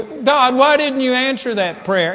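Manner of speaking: high, loud mock complaint to God; shocked complaint. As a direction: act out a baffled believer questioning God. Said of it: "we're gonna be shocked when we".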